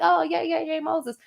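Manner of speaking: lightly voiced adoring crowd; cheering crowd. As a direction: imitate the crowd hailing Moses. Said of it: "they be like".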